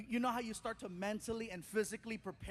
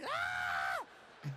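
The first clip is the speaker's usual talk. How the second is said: hearty laugh. Retold or imitated. Son dying laughing.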